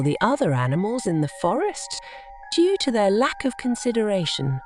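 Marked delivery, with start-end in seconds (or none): none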